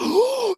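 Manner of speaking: mock deep-breath gasp. Shown as a demonstration. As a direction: suck in a big demonstrative breath. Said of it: "take a deep breath".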